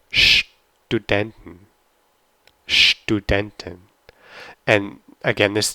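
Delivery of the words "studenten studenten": "Studenten" slowly, with "sht" at the start; German pronunciation. Shown as a demonstration. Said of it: "S T is sht".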